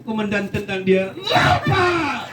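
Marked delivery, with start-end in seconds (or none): 1.1-2.3 annoyed shout. Retold or imitated. Irritated commander kicks the recruit out, shouting. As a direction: yell it while kicking him out. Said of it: "karena komandan jengkel".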